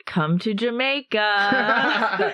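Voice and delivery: put-on slow Jamaican accent, lightly done. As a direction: drawl it like a Jamaican tourism ad.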